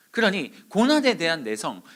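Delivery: usual speaking voice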